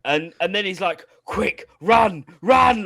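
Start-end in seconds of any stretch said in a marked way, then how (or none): 1.2-2.8 louder, higher mock urgent yell; urgent shout. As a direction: hurry her away with a hammed-up shout. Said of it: "like that".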